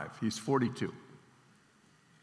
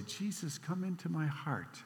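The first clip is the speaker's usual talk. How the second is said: put-on higher and much softer, a tiny girl's voice; a three-year-old repeating after her brother, higher and much softer; squeaky little girl; 3 semitones higher, 12 dB quieter than usual. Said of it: "her squeaky little voice".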